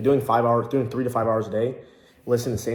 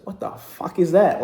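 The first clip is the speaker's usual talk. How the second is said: confused coworker; 6 semitones higher, 5 dB louder, slower than usual. Retold or imitated. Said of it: "he was like".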